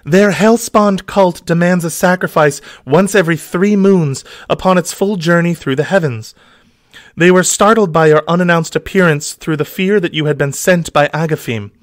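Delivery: high, breathy-free damsel voice. Acted.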